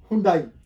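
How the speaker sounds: हुंडई as "hun-DAA-ee"; wrong "hoon-dai" reading of हुंडई; common mispronunciation. Shown as a demonstration. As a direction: say हुंडई with an oo first and an "ai" ending. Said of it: "हुंडई नहीं है".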